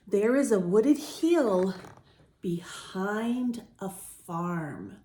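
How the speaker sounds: slow, gentle read-aloud; story narration. A narrator's reading. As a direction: read it calmly to young listeners. Reads picture book to children.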